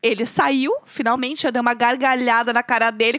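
usual speaking voice

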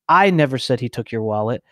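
"I" leaned on at the start, rest even; stress on I. Shown as a demonstration. Showing how emphasis changes meaning, stress on "I". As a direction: put the emphasis on the first word "I". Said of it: "put emphasis on a different word".

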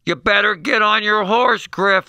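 mock yell, high and loud; irate sideline mother. Mother to her kid at a soccer game, mock angry yelling. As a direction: imitate a mother hollering at her kid on the field. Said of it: "Veins in her neck popping".

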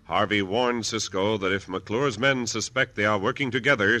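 ordinary talk, nothing marked

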